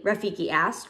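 ordinary delivery

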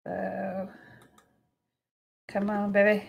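soft coaxing to the paint; coaxing quietly. Real, not acted. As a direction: coax it along under your breath. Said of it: "talk to their paint like I do".

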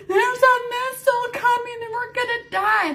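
high-pitched mock panicked wail; retold terror. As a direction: act out a frightened call to family. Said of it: "shitless scared".

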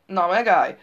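loud, menacing hiss; bloodthirsty hiss. A narrator's reading. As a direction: threaten him, out for blood. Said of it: "sziszegte a pasas vérszomjasan". (vérszomjas, fenyegető sziszegés)

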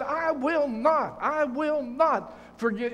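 drawn out, dug-in refusal, word by word; slow stubborn refusal. Retold or imitated. Preacher voicing the couple's refusal, slow and dug in. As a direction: say it slowly like you'll never budge.

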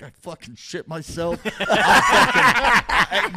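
laughing through it; breaking up in laughter. Real, not acted. Laughing at own story.

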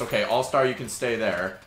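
ordinary talk

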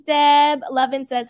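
ordinary delivery

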